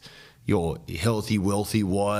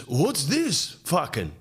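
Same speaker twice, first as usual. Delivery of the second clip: high, drawn-out put-on dad voice; hammed-up fatherly disbelief; outraged dad; 9 semitones higher, slower than usual. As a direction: demand an explanation, slowly. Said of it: "his dad walks in and he goes".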